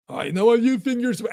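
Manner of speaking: high, sneering ego voice; mock yourself in a high sneer; mocking inner voice. Inner ego taunting you in a high voice.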